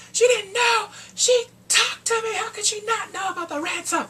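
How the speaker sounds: high, loud mock lawyer protesting. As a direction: act out the lawyer shouting. Lawyer quoted in court.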